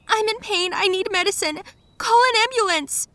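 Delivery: high, loud shout through pain. Retold or imitated. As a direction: yell for help, desperate. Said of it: "I shouted to him".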